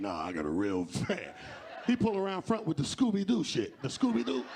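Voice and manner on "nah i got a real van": much lower, slow, proud; deep slow dude. Acted. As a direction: lower the voice and brag about the van. Guy proudly replies, voiced deep.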